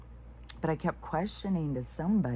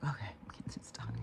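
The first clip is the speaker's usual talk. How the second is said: grumbled whisper; 10 semitones lower, 10 dB quieter, faster than usual. Retold or imitated. Grudging reply to the nagging mom.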